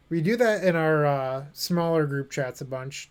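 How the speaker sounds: ordinary talk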